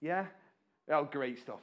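normal talking voice

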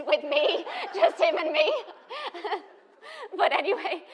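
high, loud laughter through the words; real laughter breaking in; laughing. Real, not acted. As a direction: giggle through the memory. Laughing at herself.

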